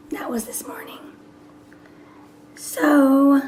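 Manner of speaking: soft whisper with a worn-out voice; whispering a sleepy goodbye before a nap; lost voice. Real, not acted. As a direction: whisper it, the voice is gone. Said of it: "my voice is messed up".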